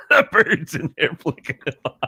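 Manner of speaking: words breaking up into laughter; cracking up at your own joke; laughing through punchline. Real, not acted. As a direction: barely get it out for laughing.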